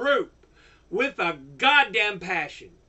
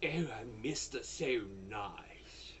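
mocking polite voice; 11 semitones lower, 16 dB quieter than usual. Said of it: "You can't sit there and be".

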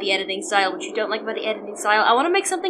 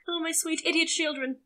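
ordinary talk

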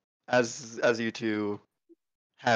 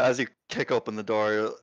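ordinary talk

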